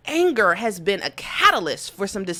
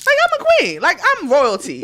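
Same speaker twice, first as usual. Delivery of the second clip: high mock regal; 8 semitones higher, 5 dB louder than usual. Claiming royalty.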